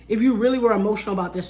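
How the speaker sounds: ordinary talk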